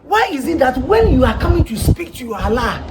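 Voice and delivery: ordinary delivery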